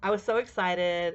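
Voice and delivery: normal talking voice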